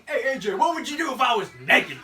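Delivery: loud, high, hammed-up customer voice; mock creepy customer. Co-host spoofing the creepy customer to A J, hammed up. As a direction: blurt it out as a laughing parody.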